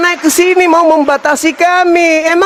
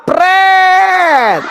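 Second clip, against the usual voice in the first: sharp high scoffing "Pret!" thrown at the name; mocking pret; 5 semitones higher than usual. Preacher scoffs at the borrowed church name, mocking. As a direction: scoff it out like a raspberry.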